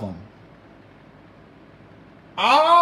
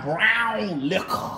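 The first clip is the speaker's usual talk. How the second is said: drawn out; much slower than usual. Pretend. Savouring the words.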